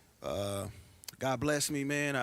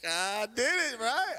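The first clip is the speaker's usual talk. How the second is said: ordinary talk, nothing marked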